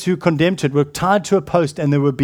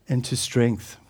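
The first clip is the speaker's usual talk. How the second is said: usual speaking voice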